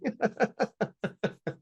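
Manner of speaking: a high burst of laughter at his own joke; high giggle. Real, not acted. Laughing at his own joke about Tatum, high giggle.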